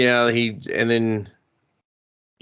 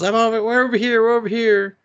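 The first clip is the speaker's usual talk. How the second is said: high, loud call giving themselves away; yelling; 11 semitones higher, 4 dB louder than usual. Retold or imitated. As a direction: yell it out, eager to help. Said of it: "and yells".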